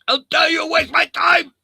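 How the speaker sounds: high, loud angry shout; mock furious scammer. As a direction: yell like an outraged scammer. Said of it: "they'll be sweating".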